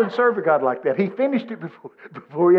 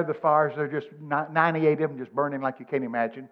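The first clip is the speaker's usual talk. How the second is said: usual speaking voice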